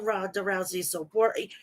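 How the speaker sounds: ordinary talk, nothing marked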